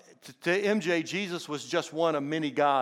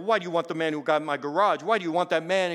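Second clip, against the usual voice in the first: put-on Indian accent; 2 semitones higher, 5 dB louder than usual. Baffled husband questioning his dying wife, imitated Indian accent. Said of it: "M J had a distinct accent".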